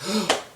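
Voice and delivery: short high gasp; mock gasp. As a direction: gasp in pretend amazement. Acted gasp for McGonagall.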